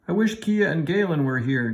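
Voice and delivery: mild yelp. A narrator's reading. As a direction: squeak the wish out in fright. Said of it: "Julie yelped".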